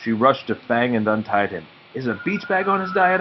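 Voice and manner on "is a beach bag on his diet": voiced question. A narrator's reading. Nate's dry question, voiced higher.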